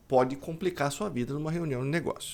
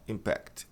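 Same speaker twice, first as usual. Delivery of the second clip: "impact" as im-PACT, quiet and low; softly said, late-stressed "impact"; 4 semitones lower, 7 dB quieter than usual. Shown as a demonstration. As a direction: show the wrong "impact" stressed on its second syllable. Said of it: "Com a tônica aqui".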